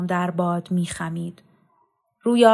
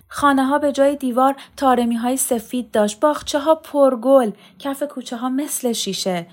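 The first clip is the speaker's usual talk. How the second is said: high, animated voice full of wonder; excited dream telling; 6 semitones higher, 4 dB louder than usual. A narrator's reading. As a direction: marvel aloud at a beautiful dream. Boy recounts his radiant dream. (با هیجان و شگفتی، صدای بالا)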